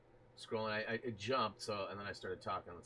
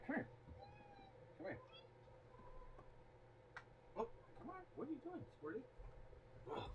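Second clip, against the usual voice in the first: pet talk; 11 dB quieter, faster than usual. Real, not acted. Cooing at the cat.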